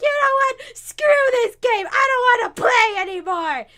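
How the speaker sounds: high, loud, petulant outburst; sore loser. Acted. Trunks quitting the board game, sore-loser shouting. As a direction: throw a loud tantrum over losing. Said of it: "being a sore loser".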